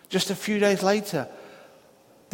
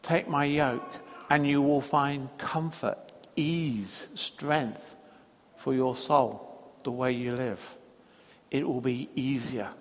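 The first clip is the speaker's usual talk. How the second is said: softer, lower, slow tender offer; gentle invitation; 6 semitones lower, 5 dB quieter than usual. Acted. Jesus invites the weary to rest, soft and tender. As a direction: lay it down softly like a promise.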